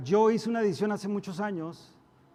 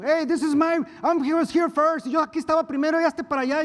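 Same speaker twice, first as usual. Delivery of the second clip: much higher and much louder, picking a fight in line; acting out a quarrel; 11 semitones higher, 11 dB louder than usual. Pastor acting out a line-cutting quarrel at the bank, shouting. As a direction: shove back at someone who cut in front of you. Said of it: "Y si yo me puse el tú por tú".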